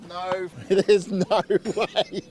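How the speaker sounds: normal talking voice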